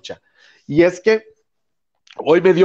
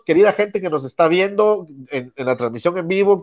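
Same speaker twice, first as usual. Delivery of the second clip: ordinary delivery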